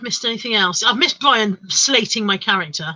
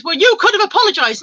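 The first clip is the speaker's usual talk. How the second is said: high, louder imitated scolding; raised-voice telling-off, retold; indignant accusation; 10 semitones higher, 5 dB louder than usual. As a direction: act out an accusing retort. Acted quarrel, accusing retort.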